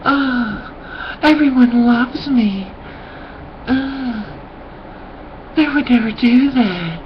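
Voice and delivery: high, slow, sing-song mock voice; mocking naive people. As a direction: sneer at smug, naive friends. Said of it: "people are like".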